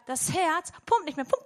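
ordinary delivery

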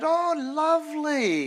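high, slow, gushing delight; gushing witch. Retold or imitated. Witch delighted by the visitor, slow high gush. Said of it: "She said".

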